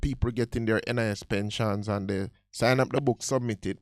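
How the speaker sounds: ordinary talk, nothing marked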